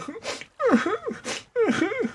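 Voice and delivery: high-pitched pretend sobs; squeaky, soft little boo-hoos; mock crying. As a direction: weep in short high bursts, hammed-up.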